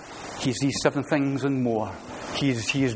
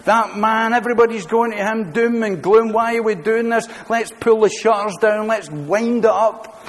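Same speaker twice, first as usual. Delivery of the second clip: higher and louder moaning complaint; whinging disciples; 6 semitones higher, 4 dB louder than usual. Retold or imitated. Preacher acting complaining disciples to John, higher louder whinge. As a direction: moan it like fed-up followers ready to quit. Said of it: "moaning and whinging and complaining".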